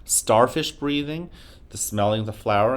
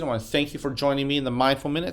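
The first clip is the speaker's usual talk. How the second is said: usual speaking voice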